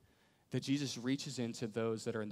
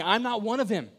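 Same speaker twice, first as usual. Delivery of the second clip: much higher and louder, furious; cursing denial; 8 semitones higher, 9 dB louder than usual. Acted. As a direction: spit out the denial. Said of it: "he calls down a curse".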